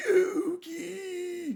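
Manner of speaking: very high, desperate cry of the name; trapped grandpa calling out for help; Grandpa crying Yugi. Retold or imitated. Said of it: "and he's like".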